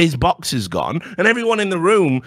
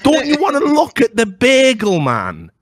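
mock goading; 8 semitones higher than usual. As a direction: needle someone with exaggerated enthusiasm. Goads Sam with the mocked line.

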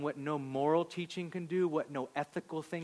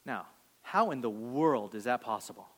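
ordinary talk

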